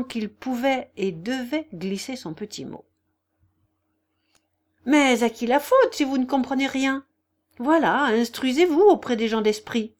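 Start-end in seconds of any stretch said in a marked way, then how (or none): 4.9-9.9 loud, higher-pitched retort; triumphant put-down; almost triumphant. A narrator's reading. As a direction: crow a little as you answer back. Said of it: "répliqua presque triomphalement Daria Alexievna".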